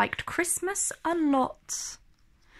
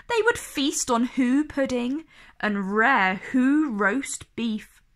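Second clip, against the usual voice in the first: usual speaking voice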